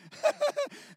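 very high, squeaky nervous giggle; imitating his own high-pitched giggle. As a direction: giggle like a starstruck kid. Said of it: "this weird sort of giggle".

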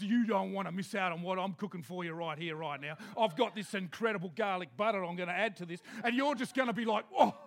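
low, fast, chatty chef pitch; Jesus the showman cook. Retold or imitated. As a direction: sell the meal like a TV cook. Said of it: "he says".

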